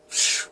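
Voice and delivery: soft voiceless teleport whoosh; hushed whoosh for someone vanishing; imitated vanishing whoosh. A narrator's reading. Elliot disappears in a ball of light, whooshing sound. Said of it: "Elliot disappears".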